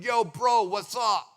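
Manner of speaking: loud, hammed-up buddy greeting, fake cool; faked street greeting. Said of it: "we try to fake it".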